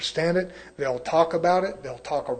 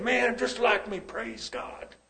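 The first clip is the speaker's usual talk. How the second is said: self-satisfied dad; 6 semitones higher, 6 dB quieter, slower than usual. Retold or imitated. Voices self-satisfied dad's thought.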